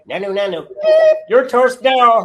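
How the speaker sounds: loud, high comic outburst; manic inner voice. Acted. Comic voicing what he's screaming inside, manic. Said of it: "But on the inside, he's going".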